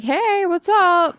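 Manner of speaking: high, slow, breezy "hey, what's up"; clueless cheery friend. Retold or imitated. Friend calling back breezily after days of silence, high and slow. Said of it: "you called me and was like".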